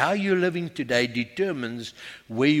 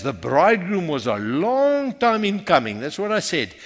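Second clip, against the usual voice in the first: usual speaking voice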